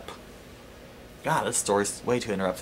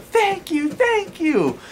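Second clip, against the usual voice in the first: mock ecstatic yell; 3 dB louder, much slower than usual. Seller leaning on the car, high mock yelling thanks. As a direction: squeal it out as the overjoyed woman.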